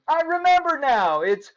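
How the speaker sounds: high, loud excited shouting; yelling. A narrator's reading. Clark shouts the remembered rules to Joey. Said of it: "Clark yelled".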